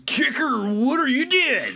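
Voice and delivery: very high, whining mock-idiot voice; mock dopey idiot. Ironhide baffled by Kicker, high mocking idiot voice.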